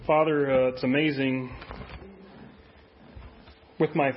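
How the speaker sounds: low, subdued praying voice; closing prayer. Real, not acted. Pastor praying to the Father for true devotion, hushed prayer. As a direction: pray softly and low, pausing between phrases.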